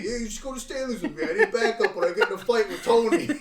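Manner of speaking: mock impression of friend. Friend mocks host's invitation.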